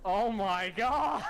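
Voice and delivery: slow, high "Oh my God" through a laugh; stunned laughing exclamation; laughing disbelief. Real, not acted. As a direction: burst out laughing in shock. Player reacts to shocking demand.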